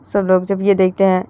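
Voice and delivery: plain reading voice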